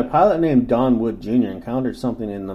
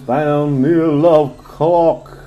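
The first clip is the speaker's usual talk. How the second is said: sexy voice. Pretend. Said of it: "You gotta say it in a sexy voice".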